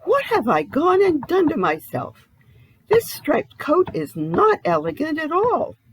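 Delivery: high, loud dismay at his reflection; shocked exclamation. Acted. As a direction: recoil in horror at yourself. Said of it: "he exclaimed".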